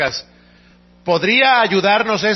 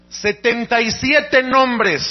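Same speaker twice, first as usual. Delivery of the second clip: setenta y SIETE nombres, slow, higher and firm; emphatic slow count; 4 semitones higher, much slower than usual. Real, not acted. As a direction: drag out the number to impress the crowd. Stressing the long list. (énfasis lento en "setenta y siete")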